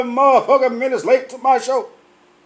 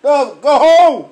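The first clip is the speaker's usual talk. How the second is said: higher, loud, slowed go home; mock telling off; 5 semitones higher, 5 dB louder than usual. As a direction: shoo them home loudly. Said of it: "I'm like".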